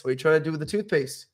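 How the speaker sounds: ordinary delivery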